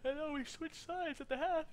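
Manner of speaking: talking through a yawn; words stretched by a yawn. Real, not acted. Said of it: "Excuse my yawning".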